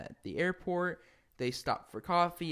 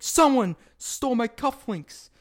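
higher, louder mock outrage; furious Donnelly; 9 semitones higher, 8 dB louder than usual. George Donnelly complaining of stolen cufflinks, mock fury. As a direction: huff like a robbed old man. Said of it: "he's furious".

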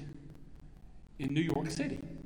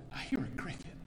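whispered, softer exchange; whispering; 6 dB quieter, faster than usual. Acted. Story friends whisper about the cricket, in a whisper. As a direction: whisper it as if not to scare it.

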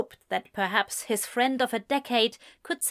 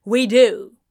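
firm; 10 dB louder than usual. A narrator's reading. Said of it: "Gabriel said with a nod".

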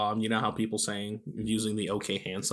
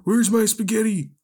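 high, loud put-on caricature voice; Fox News; 11 semitones higher, 7 dB louder than usual. Impression of Fox News for a friend, high loud caricature. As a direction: shout it in a high, silly voice. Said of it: "this one's gonna be Fox News".

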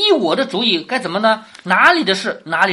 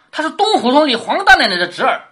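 higher, mildly raised storytelling voice; retold calling out; 6 semitones higher, 4 dB louder than usual. Servant tells master through the window who he is, mock calling out. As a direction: pipe up as if from outside, lightly acted.

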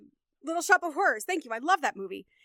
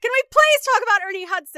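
much higher and louder, bursting with enthusiasm; excited outburst; 8 semitones higher, 11 dB louder, faster than usual. Real, not acted. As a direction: shout his name like a fan who can't hold back. Enthusiastic plea about favorite actor.

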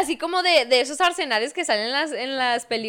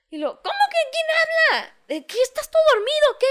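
high, quick scolding mother voice; high-pitched nagging mother, fast; mock mom on phone; 13 semitones higher than usual. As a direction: play the pushy mom on the line. Mom voice on prank call.

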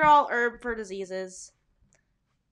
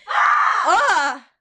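sudden, loud, high cry; loud excited shout; 5 semitones higher, 11 dB louder than usual. Shown as a demonstration. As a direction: let out one big, boisterous yell. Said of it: "like, loud and excited".

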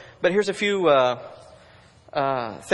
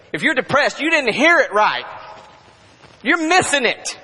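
preaching climax; 8 semitones higher, 5 dB louder than usual. Real, not acted.

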